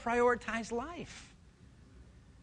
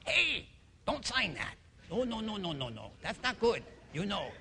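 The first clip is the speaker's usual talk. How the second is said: Tattoo from Fantasy Island; 5 dB quieter than usual. Acted. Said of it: "my voice suddenly became that of Tattoo from Fantasy Island".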